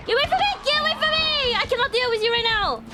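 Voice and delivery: high, frantic plea to back off; panicked yelling. Real, not acted. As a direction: shriek it at a pursuer. Fleeing attacker in game.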